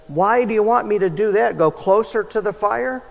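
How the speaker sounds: a hint of a nervous pilot's worry. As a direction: pilot pushing back, uneasy about flying near the fire. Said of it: "anxious and nervous, said".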